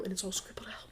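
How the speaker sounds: quick, breathy whisper with a giggle; secretive whispered telling; hushed whisper. Acted. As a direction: whisper it like you're sneaking. Recapping a secret snooping scene.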